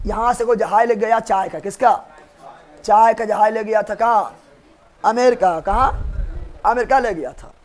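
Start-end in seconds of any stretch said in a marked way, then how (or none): none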